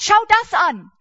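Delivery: loud, high, urgent call to look; Jesus urging worried listeners to look at sparrows, calling out. Acted. Call it out like pointing at the sky. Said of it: "Und Jesus sagt".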